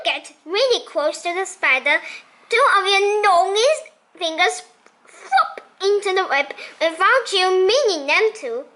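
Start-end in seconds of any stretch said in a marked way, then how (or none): none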